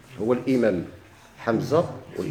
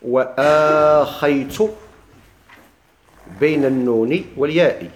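slow, drawn-out verse recitation; measured didactic chant of a memorized line; reciting the author's verse; slower than usual. Acted. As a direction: recite with long held vowels and pauses. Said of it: "ثم قال المصنف".

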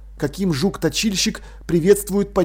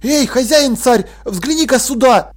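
raised, loud call; exclaiming; 6 semitones higher, 5 dB louder than usual. A narrator's reading. Jern summons the king to the wall, exclaiming. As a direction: call out eagerly, pitched up. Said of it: "воскликнул Джерн".

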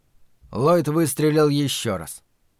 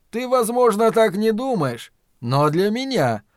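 tender; 7 semitones higher than usual. A narrator's reading. Flagg correcting his frightened servant, tenderly. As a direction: gently coax him, stretching the words. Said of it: "В голосе Флэга слышалась нежность".

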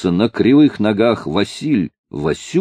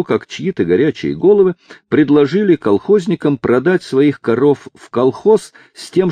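plain reading voice

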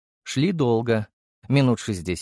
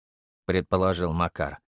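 ordinary delivery

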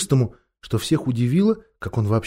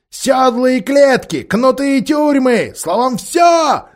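high ape voice building to a shout; ranting ape voice; 12 semitones higher, 7 dB louder than usual. Acted. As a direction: whip up the crowd with promises.

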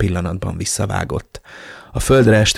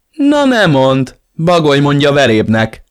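mocking retort; 6 semitones higher, 6 dB louder than usual. A narrator's reading. Tony jeers back at the narrator, mocking.

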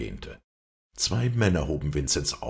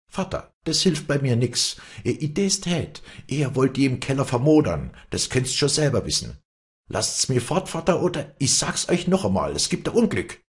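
high-pitched, urgent defiance; defiant warning; 10 semitones higher, 4 dB louder than usual. A narrator's reading. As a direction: warn your father, pitched way up. Daughter defies father and threatens him.

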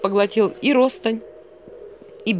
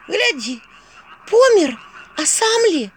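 put-on high, loud startled girl; startled discovery; 11 semitones higher, 6 dB louder, slower than usual. Vasilisa spots a man lying at the crossroads, startled. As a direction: blurt it out, pitched well up.

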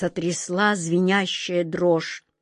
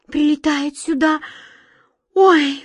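high, loud frightened yelp; startled cry; 7 semitones higher, 5 dB louder than usual. A narrator's reading. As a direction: jump with fright on the last word. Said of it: "вздрогнула Глазастик".

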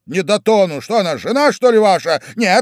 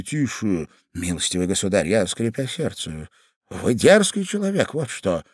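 hushed quarrel; 8 semitones lower, 5 dB quieter than usual. Acted. Whispered quarrel in dark stairwell.

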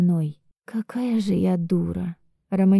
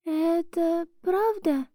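tearful; 10 semitones higher, 5 dB quieter, slower than usual. Acted. Heroine desperate on phone.

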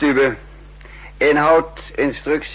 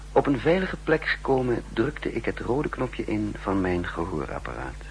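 lower, softer narrator voice, looking back; story narration; 4 semitones lower, 6 dB quieter than usual. A narrator's reading. Sheeran recalling his scheme to the listener, in a low narrating voice. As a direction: tell it like a quiet inner monologue.

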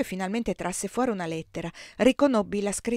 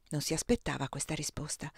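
usual speaking voice

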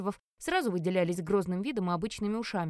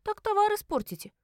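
high-pitched, raised-voice protest; sharply higher, indignant exclamation; cheeky protest; 12 semitones higher, 4 dB louder than usual. Acted. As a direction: throw it back at your jailer. Пленница дерзит тюремщику.

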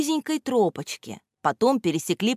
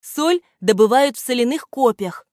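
louder, lecturing teacher tone; teacherly tone; 6 semitones higher, 5 dB louder than usual. A narrator's reading. Gramoteyka lectures the children: teacherly tone. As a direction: state it like a teacher to pupils. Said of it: "учительским тоном добавил Грамотейка".

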